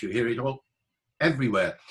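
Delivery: usual speaking voice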